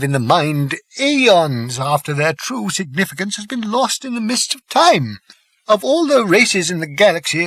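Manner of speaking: put-on a wise old planet-builder explaining gravely; elderly Slartibartfast.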